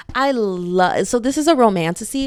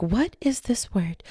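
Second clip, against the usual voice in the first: whisper; 6 dB quieter, slower than usual. Retold or imitated. Confused reading a strange name.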